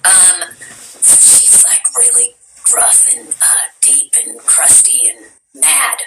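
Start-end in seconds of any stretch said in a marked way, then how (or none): 1.7-6.0 low gruff whispered growl, deep and crusty; gruff hoarse villain rasp; Frost voice. Shown as a demonstration. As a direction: growl it out like a cranky ice creature. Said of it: "What does Frost sound like?".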